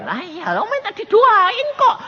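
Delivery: high, drawn-out protest; lightly mocked pleading reply; imitated visitor. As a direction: mimic an insistent visitor, slow and high. Voices the visitor's eager protest.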